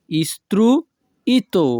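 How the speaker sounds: slow istruito, pause after "istru"; syllable by syllable. Shown as a demonstration. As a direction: break istruito in two for learners. Teacher repeats word split for learners.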